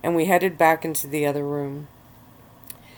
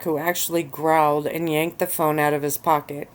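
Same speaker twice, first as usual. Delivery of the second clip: plain reading voice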